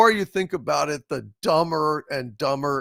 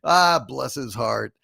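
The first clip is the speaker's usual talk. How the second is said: normal talking voice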